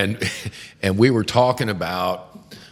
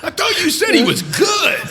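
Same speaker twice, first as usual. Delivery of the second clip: high, louder, laughing shout; a flustered client, laughing and raised; laughing mock shout; 6 dB louder than usual. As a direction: yell it through laughter in mock disbelief. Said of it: "you're like".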